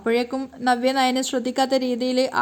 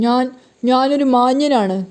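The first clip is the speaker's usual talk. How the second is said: disguised voice; 4 dB louder, slower than usual. A narrator's reading. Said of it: "ശബ്ദമൊക്കെ മാറ്റിയിട്ട്".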